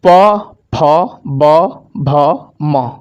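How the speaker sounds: प, फ, ब, भ, म each spoken alone, lips closing; teacher sounds out प, फ, ब, भ, म one by one; slow recital of the letters. Shown as a demonstration. Teacher shows the lip sounds प, फ, ब, भ, म, sounding each out. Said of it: "जैसे".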